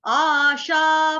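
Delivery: आशा said slowly, both aa long and loud; long vowels stressed. Shown as a demonstration. Teacher stressing the long vowels of आशा. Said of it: "ये तीनों स्वर जो हैं ये आपके दीर्घ में".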